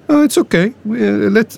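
high, dismissive retold reassurance; easygoing official. Director letting the prisoner plant, lightly imitated easygoing voice.

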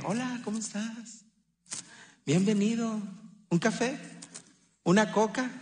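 soft, friendly Hola, ¿cómo estás? with offers of coffee; warm welcome. Shown as a demonstration. Pastor showing the loving way to greet a visitor, soft and friendly. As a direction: welcome a guest gently and kindly. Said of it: "Hay que te digan".

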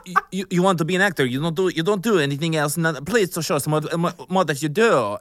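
put-on high, loud, stammering accented rant; hammed-up foreign director losing patience; exasperated director. Director berating actress who has no accents, high exasperated mock rant.

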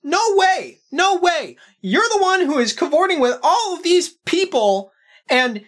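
high, mocking, taunting sibling; sneering brother. Brother Pep taunting the returning hero, high sneering voice. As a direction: needle him about being a hero.

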